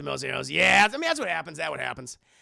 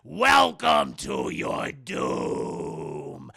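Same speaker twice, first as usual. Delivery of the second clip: much lower, very slow ominous growl; doom villain voice; 5 dB louder, much slower than usual. Retold or imitated. Mister Spuds greeting the heroes, very slow deep doom voice. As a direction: drag out doom like a movie villain. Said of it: "And Mister Spuds is like".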